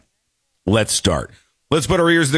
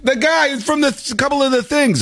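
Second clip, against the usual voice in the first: high, far-off confused voice fumbling for a name; high scatterbrained voice unable to place the actor; mock confused self; 13 semitones higher than usual. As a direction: play yourself blanking on an actor's name. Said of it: "I go".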